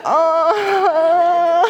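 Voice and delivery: high, drawn-out exasperated moan; long comic whimper; mock wailing groan.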